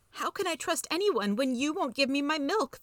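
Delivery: high, hurt mouse voice; high-pitched self-pitying plea; wounded mouse. A narrator's reading. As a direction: lament the betrayal in a squeaky voice. Betrayed mouse laments in the story.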